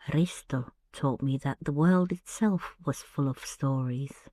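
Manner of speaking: ordinary delivery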